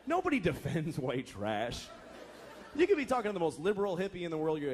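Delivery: ordinary talk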